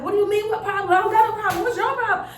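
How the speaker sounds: high-pitched, defensive snapping; acted irritated, argumentative retort; upset comeback. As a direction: fire back as if picking a fight. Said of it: "I would be so upset".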